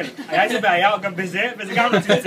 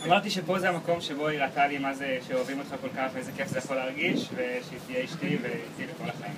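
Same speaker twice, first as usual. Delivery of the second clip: tender proposal; 7 semitones lower, 9 dB quieter than usual. Real, not acted. Proposal words, soft and low.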